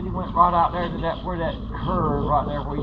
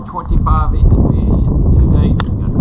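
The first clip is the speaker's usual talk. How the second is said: 1 calling out; 2 semitones higher, 11 dB louder than usual. Real, not acted. Calling news across the water.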